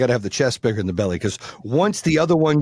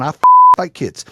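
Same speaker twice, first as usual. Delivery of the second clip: yelling lady; 5 semitones higher, 10 dB louder than usual. Retold or imitated. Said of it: "she's yelling at my son".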